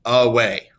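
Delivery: "Away" as a-WAY, second syllable loud; overemphasized end stress. Shown as a demonstration. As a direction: punch the WAY in "Away". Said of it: "overemphasize some of these stresses".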